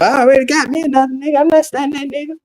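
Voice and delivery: high-pitched mock disdain; scornful girlfriend imitation. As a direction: mimic a girlfriend writing off her ex. Imitates girlfriend scorning the crying boyfriend.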